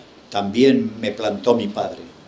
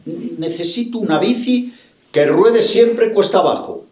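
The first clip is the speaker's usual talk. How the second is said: higher, emphatic quoted wish; acting out an old thought, pitched up high; voiced thought; 7 semitones higher than usual. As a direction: declare the wish in a raised pitch. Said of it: "porque pensaba".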